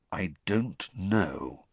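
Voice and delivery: slow, halting and thoughtful. A narrator's reading. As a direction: stretch it out, unsure. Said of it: "said Sirius slowly".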